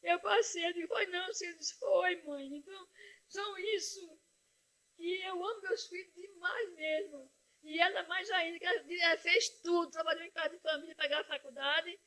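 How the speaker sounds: proud mother crying over her daughter's success; weeping. Real, not acted. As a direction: let the tears carry it.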